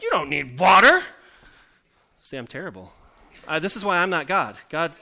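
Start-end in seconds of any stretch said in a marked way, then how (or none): 0.0-1.0 very loud, high, mock-outraged retort; petty parent's incredulous yell; indignant retort. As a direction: blast back in exaggerated disbelief. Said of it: "they were grumbling about water, I'm like".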